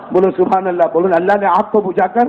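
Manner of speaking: normal talking voice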